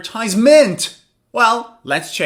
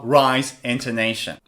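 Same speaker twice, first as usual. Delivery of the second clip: ordinary talk, nothing marked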